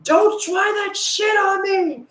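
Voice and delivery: high, strained, drawn-out yell; high-pitched retold shriek; re-enacted scream. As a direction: scream it slowly, acting out your own outburst. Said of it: "And I screamed".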